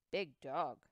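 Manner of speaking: low, slow deep-voiced Indian; lightly voiced Native man, low; Indian man's voice. A narrator's reading. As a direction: speak it low and measured. Indian speaks to Lewis.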